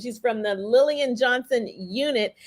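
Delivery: normal talking voice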